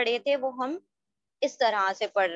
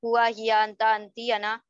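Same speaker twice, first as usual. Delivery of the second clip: हुआ, हिया, अंता, अंती, अना run together without pauses; wrong-way table reading. Shown as a demonstration. Teacher warning students off vertical reading, हुआ, हिया, अंता run together. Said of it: "आप इस तरह से नहीं पढ़ेंगे".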